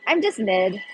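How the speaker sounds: ordinary talk, nothing marked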